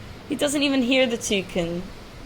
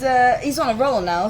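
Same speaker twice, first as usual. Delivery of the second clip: ordinary delivery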